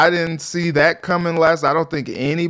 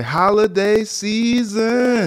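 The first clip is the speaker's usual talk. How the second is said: stretched out slow, higher, DJ hype call; DJ drop; 7 semitones higher, much slower than usual. Retold or imitated. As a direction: announce it like a club DJ drop. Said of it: "You, you would say".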